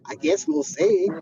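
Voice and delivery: put-on slow, teasing Southern accent. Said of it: "Did you just have a Southern accent?".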